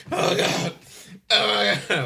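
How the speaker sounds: loud high-pitched overwhelmed groan; mock agony. Kid reacting to an extreme sour candy, mock agony. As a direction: cry out as the sourness overwhelms you. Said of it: "before you're like".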